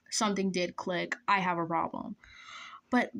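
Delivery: ordinary talk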